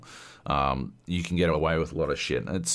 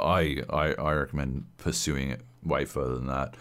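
ordinary talk, nothing marked

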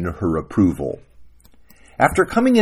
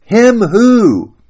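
high, loud challenge; exasperated acted shout; demanding wife; 12 semitones higher, 9 dB louder than usual. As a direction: demand an answer, pitch way up. Wife demands answer in quarrel.

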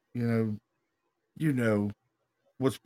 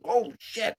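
startled curse; 9 semitones higher than usual. Retold or imitated. Retold shock from fishing rod.

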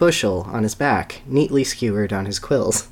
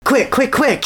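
much higher urgent panting; breathless hurry; panting urgency; 14 semitones higher than usual. Acted. Sonny fleeing through the tunnel, panting. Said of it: "he panted".

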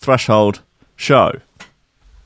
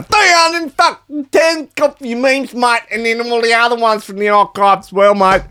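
mock Australian; 13 semitones higher than usual. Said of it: "Paulie's, um, Australian".

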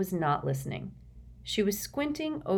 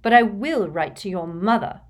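angry; 4 semitones higher, 7 dB louder than usual. A narrator's reading. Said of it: "her voice quivering with anger".

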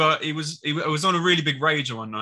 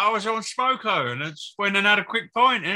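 put-on Australian accent; 6 semitones higher than usual. Guest teases the host as a drinking Australian, mock Australian accent. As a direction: ham up a laid-back Australian voice, a bit higher. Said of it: "that's the most Australian thing ever".

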